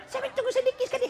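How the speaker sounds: very high, mincing voice with laughter; hammed-up high-pitched wife; wife in tight skirt.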